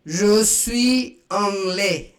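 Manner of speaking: slow, careful French; French model phrase. Shown as a demonstration. Teacher modelling French slowly for students. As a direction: say it clearly for learners to copy. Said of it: "I am English".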